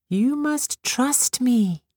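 high, slow and soothing fairy; tinkling fairy voice. A narrator's reading. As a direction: coax her to trust, slowly. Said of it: "said the birch fairy".

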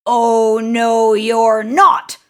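loud, forceful troll refusal; big hairy troll. A narrator's reading. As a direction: bellow it as a big troll blocking the way. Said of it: "said the big, hairy troll".

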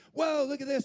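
put-on high, loud wide-eyed kid voice; amazed child blurting out; excited little boy. Boy on his first flight, excited little kid. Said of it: "there's this little boy".

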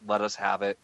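usual speaking voice

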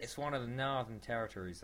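put-on a wobbly Australian accent. Mild Australian accent describing Darwin. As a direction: keep Bruce's Australian accent going. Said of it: "that's not Australian".

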